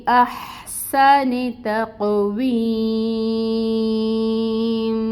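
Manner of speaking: slow melodic tajweed chant; Quranic recitation. Acted. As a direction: recite slowly, drawing out every long vowel. Teacher recites verse before rules. (تجوید کے ساتھ آہستہ سریلی تلاوت)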